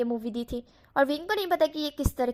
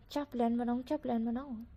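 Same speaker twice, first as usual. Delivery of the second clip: much softer, muttering to himself; quietly fading as he schemes; scheming thought; 6 dB quieter than usual. A narrator's reading. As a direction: plot under your breath. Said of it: "wing सोच रहा था".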